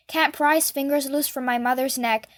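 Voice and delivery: usual speaking voice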